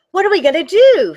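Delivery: high, fast panicked cry; panicked Missus Claus. Acted. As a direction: voice the worried mother in a rush. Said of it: "said Missus Claus".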